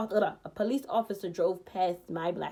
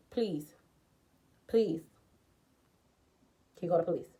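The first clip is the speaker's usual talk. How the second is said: low, soft begging; weak pleading, retold; 6 semitones lower, 7 dB quieter than usual. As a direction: plead quietly for someone to call for help. Retold plea inside gas station.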